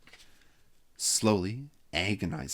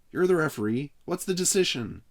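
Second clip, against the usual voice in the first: calling out; 9 semitones higher, 6 dB louder than usual. Acted. Calling to referee.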